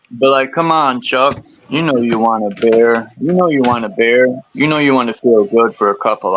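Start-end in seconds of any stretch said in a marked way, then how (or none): 1.7-3.1 coaxing craving voice, a touch put-on; lightly voiced temptation egging a friend on; tempting inner voice. 3.2-4.3 coaxing craving voice, a touch put-on, pressing harder; tempting inner voice.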